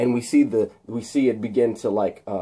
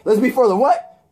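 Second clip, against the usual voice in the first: mock demand; 10 semitones higher, 5 dB louder than usual. [name] demanding repeat.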